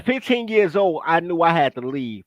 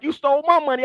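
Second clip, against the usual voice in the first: mimicking his dad; 8 semitones higher than usual. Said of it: "he like".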